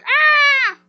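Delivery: high mock scream of a scared boy; imitated child's scream. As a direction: shriek like a kid in a panic. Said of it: "and he's like".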